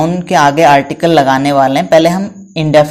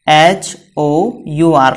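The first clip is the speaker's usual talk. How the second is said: slow spelling; much slower than usual. Shown as a demonstration. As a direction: spell each letter slowly. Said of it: "क्या लिखा मैंने?".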